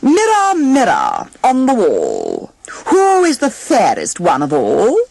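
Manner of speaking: a vain queen calling on her magic mirror; queen asks her mirror. A narrator's reading. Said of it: "asked the same question".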